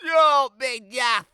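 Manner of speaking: very high, loud scoffing; mocking disbelief. A narrator's reading. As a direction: scoff at the silly tale. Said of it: "said the townsfolk".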